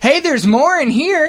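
high, slow excited call. Pretend. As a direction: beckon people in, slowly. Pastor calling people in.

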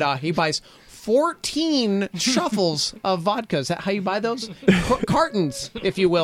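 put-on slow, wholesome 1950s kid voice; earnest golly-gee square.

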